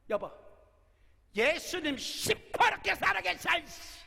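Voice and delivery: high, loud defiant cry; defiant shout. Pretend. Preacher voicing a wife who finally fights back, shouting. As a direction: shout it back, slow and fierce.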